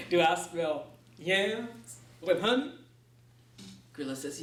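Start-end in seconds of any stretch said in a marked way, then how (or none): 0.1-2.7 Anansi voice. Acted. Anansi asks Gorilla for food.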